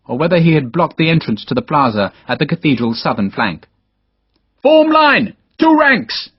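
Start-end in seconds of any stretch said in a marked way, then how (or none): 4.6-6.3 shouted order. Acted. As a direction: bellow the order much higher and louder. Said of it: "Sharp shouted".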